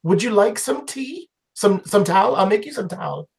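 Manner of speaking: lady's voice, pitched well up; fussy, welcoming old lady; high old woman voice. Acted. GM voices Jamar again.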